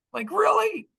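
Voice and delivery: very high, incredulous squeal; hammed-up high disbelief; retold disbelief. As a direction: squeal it as if you can't believe it. Retelling his disbelief at the answer.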